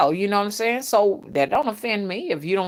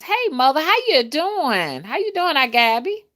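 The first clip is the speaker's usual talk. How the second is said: lightly voiced viewer; 7 semitones higher than usual. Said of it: "Gabby says".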